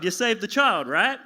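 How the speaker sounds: usual speaking voice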